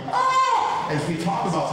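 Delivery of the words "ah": sudden much higher, louder startled ah; mock alarm scream. The panicking limbic system in a scary talk, a mock alarmed yelp. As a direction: let out a quick panicked yelp. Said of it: "my limbic system isn't going".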